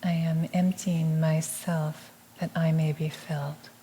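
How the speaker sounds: soft, measured meditative repeat; hushed affirmation, evenly spoken; affirmation echo. Real, not acted. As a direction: repeat it gently as a quiet response. Echoes the affirmation.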